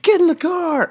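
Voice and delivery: retold yell. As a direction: yell it like fleeing danger. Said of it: "And we were like".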